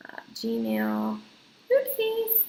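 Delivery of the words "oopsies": high little oopsie at her own typo. Real, not acted. Vlogger mistyping her email, high surprised oopsie. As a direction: squeak it out, much higher, caught in a slip.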